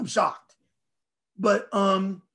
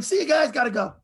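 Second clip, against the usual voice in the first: mock breezy goodbye, pitched up; joking farewell; 6 semitones higher, 3 dB louder than usual. Fan joking about escaping the game on a plane wing, joking farewell. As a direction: toss off a raised, jokey goodbye.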